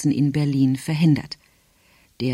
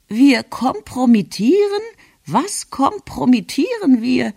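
high, incredulous echo; baffled grandmother; 9 semitones higher, 3 dB louder than usual. A narrator's reading. Grandmother repeats the delegation's word back, baffled and high. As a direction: query each word in a high-pitched voice. Grandmother echoes words she misunderstands.

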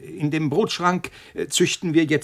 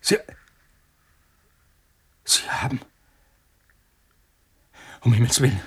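shocked horror; 5 semitones lower than usual. Acted. Scientist learns he's been poisoned.

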